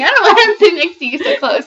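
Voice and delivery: laughing. Pretend. Teasing mock jealous voice.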